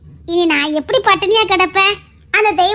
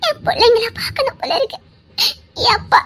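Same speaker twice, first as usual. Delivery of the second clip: tearful longing; 6 semitones higher than usual. Acted. Woman longing for her family.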